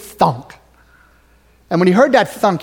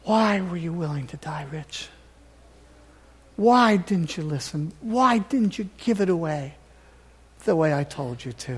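gentle Jesus; 7 dB quieter than usual. Retold or imitated. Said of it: "have Jesus Christ look into my eyes and say".

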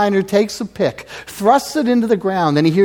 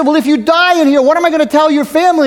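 pleading miner; 9 semitones higher, 6 dB louder, faster than usual. Retold or imitated. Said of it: "the miner said".

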